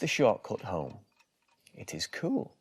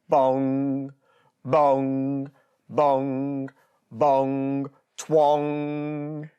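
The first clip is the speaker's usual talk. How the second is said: louder, slow ringing bongs; clock bongs; 6 semitones higher, 7 dB louder, much slower than usual. A narrator's reading. Mister Wolf's clock striking six, loud and slow. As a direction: ring it out like a big clock.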